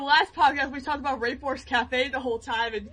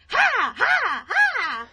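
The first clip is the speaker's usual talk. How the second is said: higher mock cackle; Sylvia's laugh; 10 semitones higher, much slower than usual. Girl imitating Sylvia's laugh. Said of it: "and then it's like".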